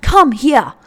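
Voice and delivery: called out loud to a dog; mock yell at a dog. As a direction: shout it across the room at the dog. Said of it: "doesn't matter if I'm yelling at her".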